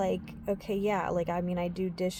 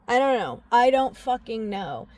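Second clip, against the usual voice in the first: exasperated, pushing the words out louder; fed up, saying it again with more force; louder frustrated repeat; 2 semitones higher, 7 dB louder than usual. Real, not acted. Venting her frustration to viewers, louder and exasperated.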